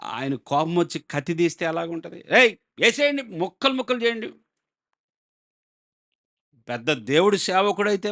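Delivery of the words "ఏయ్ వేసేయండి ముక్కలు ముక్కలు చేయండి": higher, sharp command to cut him down; a furious king shouting to his guards; angry king's order. Acted.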